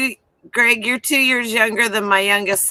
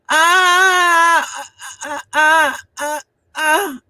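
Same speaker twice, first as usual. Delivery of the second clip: dinner rating noises; 5 semitones higher, much slower than usual. Retold or imitated. Woman mimics son at the dinner table, wordless rating noises. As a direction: imitate wordless groans of judgement. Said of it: "That's how he rates whatever I made".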